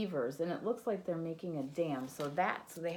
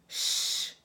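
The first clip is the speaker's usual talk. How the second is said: shush; 7 dB louder than usual. Acted. Animals hush the snoring bear.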